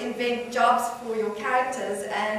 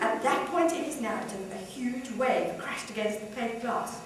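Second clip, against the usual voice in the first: usual speaking voice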